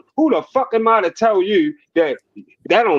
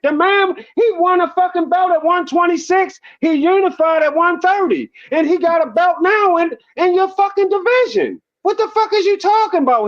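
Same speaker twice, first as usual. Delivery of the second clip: high-pitched, heated disbelief; worked-up, indignant comeback; incredulous retort; 8 semitones higher than usual. Retold or imitated. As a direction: argue back at a doubter, voice riding high.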